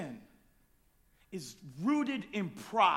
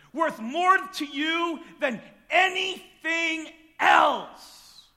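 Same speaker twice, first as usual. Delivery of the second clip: slow, loud and higher, every word hit; preached shout; 8 semitones higher, 9 dB louder, much slower than usual. Acted. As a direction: drive it home slowly. Preaching climax.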